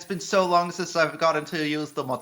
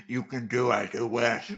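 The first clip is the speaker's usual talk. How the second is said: low character voice, mouth full of cookie; lightly voiced low, aloof game character; Gilgamesh voice; 6 semitones lower than usual. Player voicing the wrong character through a cookie, low put-on voice. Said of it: "my Gilgamesh voice was distinct enough from my Archer voice".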